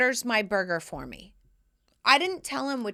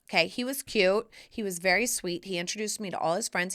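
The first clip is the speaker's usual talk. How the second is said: normal talking voice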